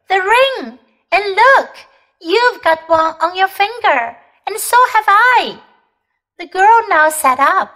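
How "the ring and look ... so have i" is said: excited. A narrator's reading. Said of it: "cried Diggory".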